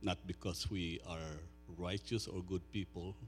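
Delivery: soft, low, reverent prayer voice. Acted. Pastor praying softly over his people before preaching. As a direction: pray quietly over the congregation.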